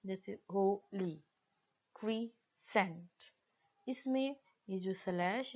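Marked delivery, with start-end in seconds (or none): 0.5-1.1 Holi in two slow beats, हो then ली; brief gap inside Holi after हो; split into syllables. Shown as a demonstration. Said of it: "ली दो words को separate किया है". 1.9-3.1 crescent slowly in two beats, क्री then Saint; split into syllables. Shown as a demonstration. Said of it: "इनको बोलते हुए ये टू सिलेबल्स वर्ड हैं".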